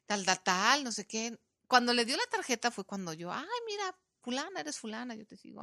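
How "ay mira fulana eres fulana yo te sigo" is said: gushing fan. Retold or imitated. Retells her fan greeting, fading.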